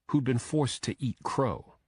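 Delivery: usual speaking voice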